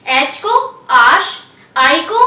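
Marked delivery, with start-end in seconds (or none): 0.9-1.3 आश said "aash"; आश, long "ah" then "sh"; French letter H. Shown as a demonstration. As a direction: say French H as आश, ending in "sh". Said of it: "pronounce किया जाता है".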